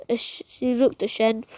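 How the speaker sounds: ordinary delivery, nothing marked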